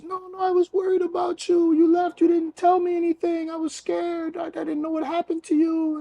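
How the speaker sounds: high falsetto, words tumbling out; tearful relief impression. Man imitating the woman finally reaching her friend, high tearful relief. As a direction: imitate a woman crying with joy, pitched high. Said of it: "she starts crying, but she's smiling".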